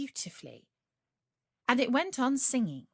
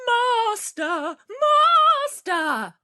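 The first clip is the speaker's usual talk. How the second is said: loud, much higher cry; harp calling out; 12 dB louder, much slower than usual. Acted. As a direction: shriek for the master. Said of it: "called out quite loud".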